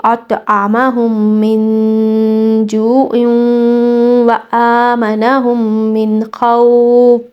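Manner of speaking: slow, drawn-out Quranic recitation; tajweed recitation. Shown as a demonstration. Teacher modelling the verse for students, slow tajweed recitation. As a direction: chant each letter with full length. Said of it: "مکمل تجوید کے ساتھ".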